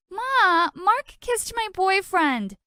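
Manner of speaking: high, slow whiny tattle; tattling whine. Acted. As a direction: whine it to Mom like a kid. Tattling to Mama, whining.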